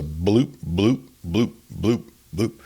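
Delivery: short rising bloops, evenly spaced; imitated water bloop. A narrator's reading. Narrator imitates the peculiar pond sound, spaced bloops. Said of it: "I heard a peculiar sound".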